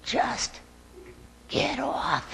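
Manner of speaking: pinned partner whispering to get off; whispered plea. Retold or imitated. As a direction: whisper it so no one hears. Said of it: "he says".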